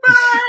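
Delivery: loud high mock wail of crying at a movie; mock bawling wail. While a sad dog movie plays, she mock-wails loudly with her son. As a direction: belt out a hammed-up sob. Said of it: "bawling ourselves".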